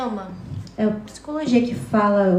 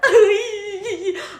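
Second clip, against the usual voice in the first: high laugh; 9 semitones higher than usual. Real, not acted. Laughs after the panic line.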